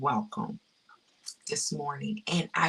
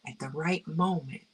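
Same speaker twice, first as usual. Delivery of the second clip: normal talking voice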